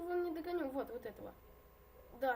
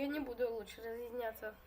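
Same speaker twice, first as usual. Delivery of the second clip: usual speaking voice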